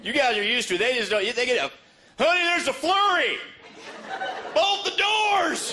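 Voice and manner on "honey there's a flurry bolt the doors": high, dragged-out mock alarm; panicked spouse. A Texan panicking over a few snowflakes, high slow mock alarm. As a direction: ham up the panic, way higher and slow.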